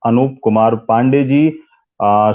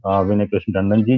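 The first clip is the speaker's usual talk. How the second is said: ordinary talk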